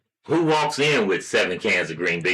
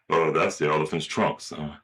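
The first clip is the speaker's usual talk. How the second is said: lightly voiced dad, lower and offhand; low casual dad reply, trailing off soft; father; 5 semitones lower, 4 dB quieter than usual. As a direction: answer as a father, pitched down. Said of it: "Hey, Dad".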